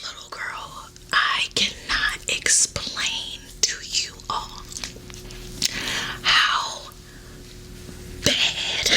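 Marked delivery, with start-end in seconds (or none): none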